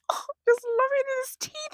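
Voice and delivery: squealing delight. Real, not acted. Gushing over cousin's smile.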